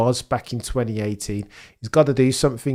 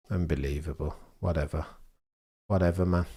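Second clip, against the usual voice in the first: low, soft and given up; deflated resignation; 4 semitones lower, 4 dB quieter, faster than usual. Real, not acted. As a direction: let the anger drain into a quiet shrug. Deflated after the rant.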